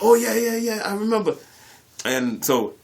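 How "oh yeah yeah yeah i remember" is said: much higher, louder flustered "oh yeah"; caught-out cover-up. Retold or imitated. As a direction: scramble to cover the lie. Caught faking, sheepish cover.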